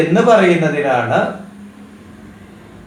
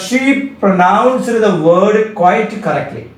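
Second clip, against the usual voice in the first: slow dictation; 3 semitones higher, much slower than usual. Shown as a demonstration. Teacher dictates example sentence.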